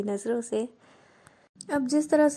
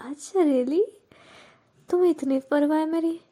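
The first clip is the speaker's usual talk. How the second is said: higher and louder playful tease; teasing delight; 6 semitones higher, 4 dB louder than usual. A narrator's reading. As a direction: gleefully catch her out caring. Teasing friend who cares. (चिढ़ाते हुए खुशी से)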